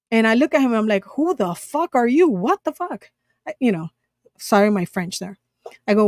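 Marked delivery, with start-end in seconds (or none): 1.1-3.1 retold indignant outburst, higher pitched; mock-outraged, high-pitched disbelief; indignant challenge. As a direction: act out an affronted challenge, pitch jumping up without shouting. Retold confrontation with intruding stranger.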